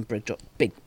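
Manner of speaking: stumbling over "Big Drop, Big"; tongue-tied. Real, not acted. Host struggling with a brewery name, tongue-tied. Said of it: "it's hard to say".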